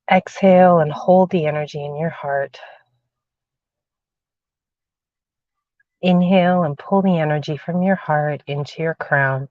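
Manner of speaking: guided meditation. Acted. Leads grounding meditation.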